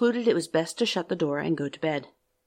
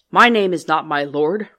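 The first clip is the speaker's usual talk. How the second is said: grotesque monster voice; 9 dB louder than usual. Acted. The monster rebukes the merchant.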